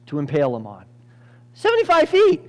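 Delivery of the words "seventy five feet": high, loud burst of amazement; amazed exclamation. Real, not acted. Preacher astonished at the height of Haman's pole, amazed exclamation.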